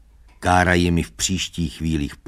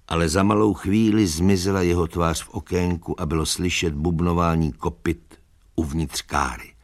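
ordinary delivery

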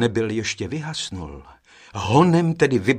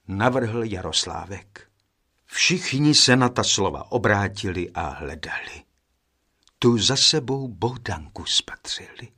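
usual speaking voice

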